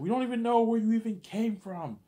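high, loud screaming rage; father disowning the boy, screaming high and loud; furious dad. Retold or imitated. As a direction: scream it down at the son like a raging father. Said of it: "He's like".

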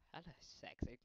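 normal talking voice

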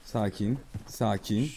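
soft, low calming voice; soothing. Pretend. Player calming a spooked horse, soft and soothing. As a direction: gently settle a nervous animal.

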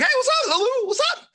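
very high, bright hey what's up; excited greeting. Shown as a demonstration. Teacher showing students an excited high-five hello, very high. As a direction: greet her like you're thrilled to see her. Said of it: "You know, just excitement".

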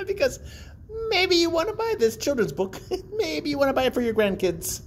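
laughing through the words, high. Real, not acted. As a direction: giggle while pitching the book. Laughing at own sales pitch.

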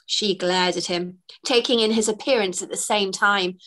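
ordinary delivery, nothing marked

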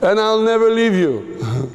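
high-pitched earnest vow, acted out; zealous. Peter promises Jesus he will stay, zealous. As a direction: pledge loyalty like an overeager disciple. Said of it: "he was so zealous".

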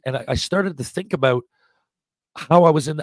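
normal talking voice

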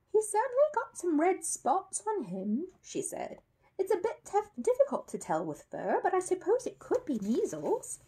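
lightly voiced kind mother; gentle lady voice. Mrs Brown voiced.

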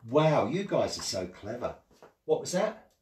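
usual speaking voice

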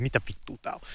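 hushed, alarmed whisper; a frightened whispered curse at the window; startled whisper. Retold or imitated. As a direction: breathe it out, spooked by gunfire. Gunshots outside, startled quote.

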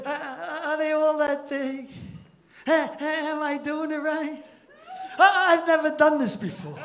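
soft, hesitant, put-on innocent voice; mock timid girl. Comic mimicking a shy lover in bed, softly hesitant. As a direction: imitate a nervous girl, quiet and halting.

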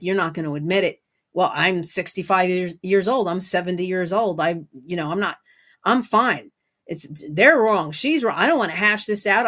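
ordinary talk

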